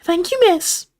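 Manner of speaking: very high elf squeak; grinning house elf. A narrator's reading. Dobby thanks Hermione, grinning elf squeak. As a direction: pipe up thanks through a grin. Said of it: "grinning toothily at her".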